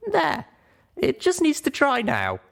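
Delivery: put-on high, eager young pony voice; small mare walking friends through a rescue; young Littlepip explaining. Littlepip finishes the gun.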